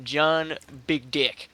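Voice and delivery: slow, deadpan joke name. Acted. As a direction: drag it out with a straight face.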